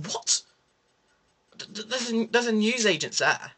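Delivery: high, incredulous stammer; stammering in mock disbelief; mock puzzled. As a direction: act baffled at the bin reader. Mock-puzzled retort to man.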